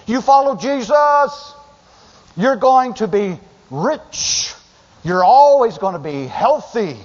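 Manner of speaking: high, slow, hammed-up prosperity preacher pitch; mock prosperity preacher. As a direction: send up the false teacher's sweet promises. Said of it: "who say".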